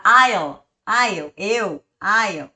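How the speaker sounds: I'll said as one quick run-together syllable, loud and clear; I'll drilled loudly as a single blended sound; contracted I'll. Shown as a demonstration. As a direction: glide I'll straight through with no break between I and will. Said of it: "fala direto, fala emendando já".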